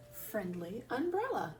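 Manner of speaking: plain reading voice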